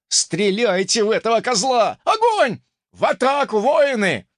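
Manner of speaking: high, loud commands; barked orders. Acted. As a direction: order the archers to fire.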